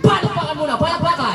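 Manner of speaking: high-pitched hype-host shout; rousing applause call. Real, not acted. Host asks the crowd for applause, high rousing call.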